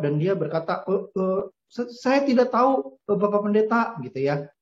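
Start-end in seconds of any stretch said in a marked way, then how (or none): 0.9-3.9 put-on a child's small, higher scared voice, stammering; timid scared boy. A narrator's reading. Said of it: "dengan sangat takut". (anak yang takut dan gugup)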